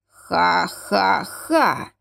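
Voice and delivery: very slow creaking ha-ha-ha; creaky laugh. A narrator's reading. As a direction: laugh like squeaking iron hinges. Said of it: "проскрипели ворота".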